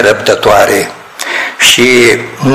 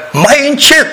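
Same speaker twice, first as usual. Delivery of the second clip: put-on much higher, hushing "mai încet" like the old director; imitating the director; 13 semitones higher than usual. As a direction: mimic a fussy boss hushing staff. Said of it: "el era ardelean".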